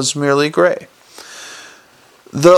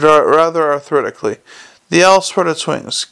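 ordinary delivery

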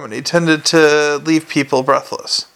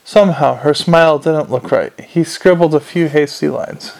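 plain reading voice

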